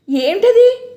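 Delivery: high, loud alarmed question; sharp alarm on arrival. A narrator's reading. Shekharam rushing in, loud alarmed question. Husband rushes in.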